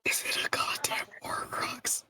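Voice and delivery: breathless, whispered disbelief; shocked whisper. Real, not acted. Player whispering in disbelief at the game master's reveal, whispered. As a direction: hush it out like a horrified realization. Stunned realization about the book.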